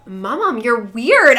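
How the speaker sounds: hammed-up appalled objection; retold protest. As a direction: call her out in playful disbelief. Retold protest to admiring grandmother.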